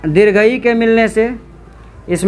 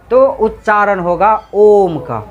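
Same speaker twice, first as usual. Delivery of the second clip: ordinary delivery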